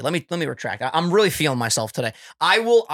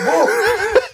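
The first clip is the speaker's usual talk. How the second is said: squealing falsetto woo into a laugh; high whoop and laugh; 4 dB louder than usual. Real, not acted. Cheering his own singing with a whoop.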